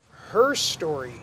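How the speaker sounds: HER drawn out, "Her story" as two words; slow "Her story", leaning on HER; stress on HER. Shown as a demonstration. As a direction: separate "Her story" clearly, weight on HER. Splits word to replace history.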